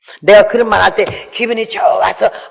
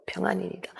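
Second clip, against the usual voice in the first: soft, hushed, composed reply; hushed serene reply; calm and quiet; 17 dB quieter, faster than usual. Retold or imitated. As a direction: answer in a quiet calm voice though your heart breaks. Repeats the woman's calm answer.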